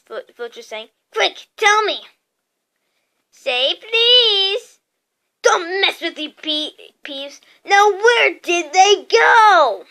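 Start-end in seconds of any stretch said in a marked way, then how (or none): 1.1-2.1 high, loud impatient order; agitated caretaker; urgent demand. A narrator's reading. Filch urgently demanding an answer. 3.3-4.8 high, loud poltergeist taunt; gleefully bratty demand; annoying sing-song. A narrator's reading. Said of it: "his annoying sing-song voice". 5.4-9.9 building to a loud, drawn-out shout; exasperated caretaker, getting higher and louder; angry demand. A narrator's reading. Filch losing patience with Peeves.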